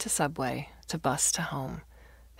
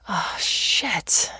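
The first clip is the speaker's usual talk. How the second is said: weary dismay; 3 semitones higher, 5 dB louder than usual. Acted. She spots empty box, exhausted.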